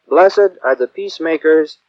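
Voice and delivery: ordinary delivery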